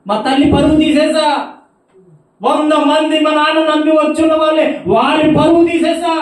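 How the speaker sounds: high, loud wailing lament; despairing caller. Retold or imitated. The woman crying to the brother about her ruined life, loud wailing lament. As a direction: wail in hopeless despair.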